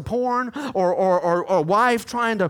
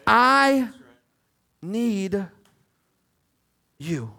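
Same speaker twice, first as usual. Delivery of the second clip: word by word; 2 semitones lower, much slower than usual. Shown as a demonstration. Preacher modelling words for congregation to say, word by word. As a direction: set each word apart, stepping down in pitch.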